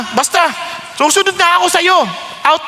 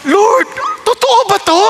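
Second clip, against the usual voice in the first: anxious plea; 8 semitones higher than usual. Retold or imitated. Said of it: "Tapos sabi ko".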